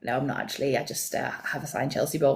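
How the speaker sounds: low, soft mock-awkward answer; retelling a small embarrassed reply; retold sheepish reply. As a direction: drop low and quiet, admitting it. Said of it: "And I was like".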